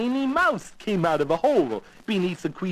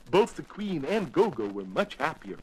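usual speaking voice

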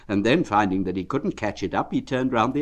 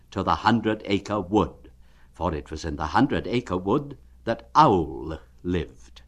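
usual speaking voice

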